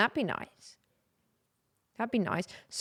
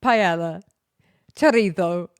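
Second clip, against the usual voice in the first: louder, over-pronounced paella and chorizo, chorizo as tho-REE-thoh; mock TikTok chef; 7 dB louder, slower than usual. Mocking the TikTok guy who says paella differently, hammed-up and louder. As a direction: ham up the exaggerated Spanish pronunciation. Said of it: "It's like".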